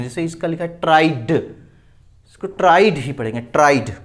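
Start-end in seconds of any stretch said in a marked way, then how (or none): 0.8-1.3 ट्राइड ending in a clearly released d; final D sound. Shown as a demonstration. Teacher showing how ED endings are read, final D. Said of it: "डी से ही करते हैं". 2.5-3.9 loud ट्राइड with a plain d ending; final D sound. Shown as a demonstration. Teacher telling students how to read it, final D. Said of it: "डी ही आएगा".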